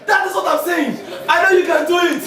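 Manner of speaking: goal celebration. Real, not acted. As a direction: celebrate the goal with a laughing shout.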